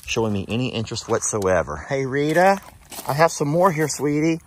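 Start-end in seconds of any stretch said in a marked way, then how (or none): 1.9-4.4 high, louder sweet coaxing; coaxing the donkey. Real, not acted. Owner coaxing Rita to eat from her hand, high and sweet. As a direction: coax a shy animal to your hand.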